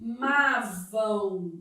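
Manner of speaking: "amavam" very slow, syllable by syllable; teacher sounding out "a-ma-vam"; demonstrated diphthong. Shown as a demonstration. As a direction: stretch "amavam" low, ending in a nasal "ão" glide. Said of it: "Tipo assim".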